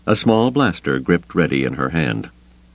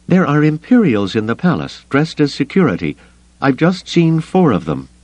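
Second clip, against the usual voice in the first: higher, tense woman's voice; urgent, tense female voice; Mara's voice; 9 semitones higher than usual. Acted. Mara voiced, urgent report.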